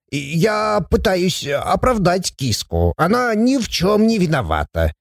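high, soft pleading voice; sheepish put-on dwarf excuse; meek, deflated dwarf. A narrator's reading. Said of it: "тут же сник".

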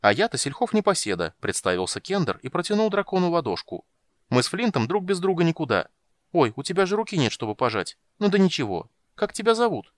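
high, lively chattering kender; chatty kender. A narrator's reading. Tass chatters to dragon.

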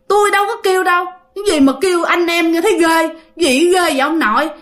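high-pitched, acted protest; indignant denial. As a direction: deny it as if falsely accused. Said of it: "bà ngoại mới chối". (giọng chối phăng, khó chịu, lên cao)

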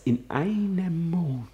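in einem Mond whispered high with a falling end; bitter whisper. Acted. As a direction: hiss it under your breath in disbelief. Soliloquy, repeated lament.

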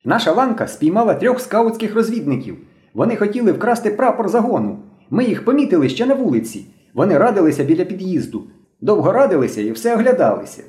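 put-on high-pitched excited boy; agitated report. A narrator's reading. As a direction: report eagerly to the leader in a high voice. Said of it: "швидко хвилюючись, заговорив".

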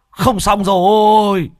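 high, loud, slow despairing cry; drawn-out wail. Acted. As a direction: stretch rồi out in dismay. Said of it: "Mò Bá Cha hét lên".

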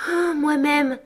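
soupir aigu, un peu las; un soupir de résignation effrayée; en soupirant. A narrator's reading. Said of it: "dit-elle en soupirant". (soupiré, voix plus aiguë)

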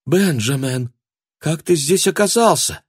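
high, wondering woman ghost; ghostly woman. A narrator's reading. Dead wife appears.

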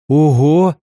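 loud, high "Ого!"; excited exclamation. A narrator's reading. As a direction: burst out in wonder. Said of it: "воскликнул Константин".

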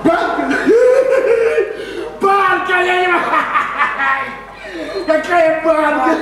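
high, laughing incredulous repeat; laughing disbelief. Acted. Husband laughing at wife's gossip, incredulous laughter. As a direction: crack up at the ridiculous word.

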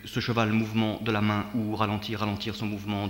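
plain reading voice

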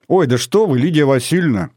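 high, lively exclamation; exclaiming. A narrator's reading. Girl guest to friend's mother, exclaiming. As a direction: exclaim politely, voice lifted. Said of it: "воскликнула Аля".

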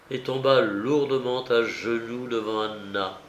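ordinary delivery, nothing marked

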